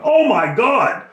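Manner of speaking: horrified loud oh my God; shocked exclamation. Retold or imitated. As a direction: react to a face full of ants. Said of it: "I'm like".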